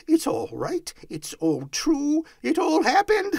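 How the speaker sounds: high voice building to loud glee; rising, elated exclaiming; excited crying out. A narrator's reading. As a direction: build from delighted discovery to triumph. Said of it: "cried Scrooge".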